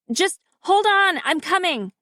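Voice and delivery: high, urgent call to hang on; urgent reassurance. A narrator's reading. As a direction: hurry to him while calling out. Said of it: "I say".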